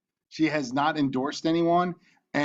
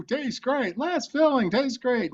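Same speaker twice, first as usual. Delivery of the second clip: much higher mock shouting match; arguing ad actors; 10 semitones higher, slower than usual. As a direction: bicker it back and forth like the beer ad. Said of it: "And they would argue".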